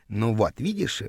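softly murmured relief; relieved whisper. A narrator's reading. Girl to boy while hiding from the rival, hushed. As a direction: keep it low, as if hiding. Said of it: "облегчённо прошептала она".

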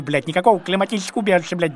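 ordinary delivery

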